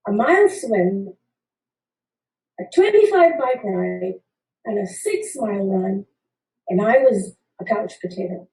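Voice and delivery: ordinary talk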